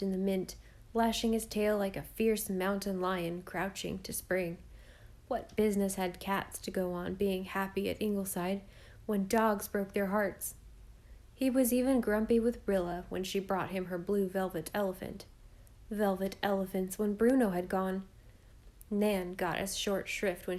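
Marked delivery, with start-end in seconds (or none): none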